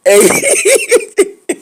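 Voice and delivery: high burst of laughter, almost a shout; loud shrieking laugh. Real, not acted. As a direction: throw your head back and shriek with laughter. Cackling at own joke.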